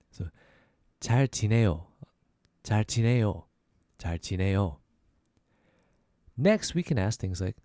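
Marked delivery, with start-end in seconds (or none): 1.0-4.8 잘 지내요 joined up, dropping at the end; falling statement tune. Shown as a demonstration. Teacher repeating the answer, brought down at the end. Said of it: "we bring it down".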